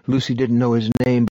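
ordinary delivery

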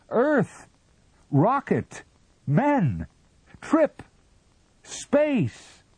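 very high and loud; frantic single-word cries. A narrator's reading. Captain shouts words to the Martian, high and desperate. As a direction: fire each word out in desperation.

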